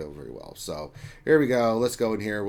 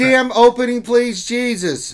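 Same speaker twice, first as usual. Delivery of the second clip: loud, much higher, fed-up yell at the video; exasperated outburst; 12 semitones higher, 11 dB louder than usual. Real, not acted. Streamer fed up with the intro, yelling loud and high. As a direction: snap loudly, out of patience, to get the intro skipped.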